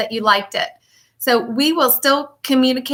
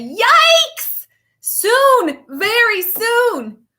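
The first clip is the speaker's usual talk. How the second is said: squeaky, much higher yelp, then slow very soon; startled grandpa; 11 semitones higher, much slower than usual. Acted. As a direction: jump in alarm, then drag out the soon. Grandpa surprised, looking at clock.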